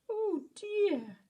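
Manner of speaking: high, tearful little voice; a scared rabbit whimpering; lost bunny near tears; little cry of worry. Acted. Frightened rabbit's lament.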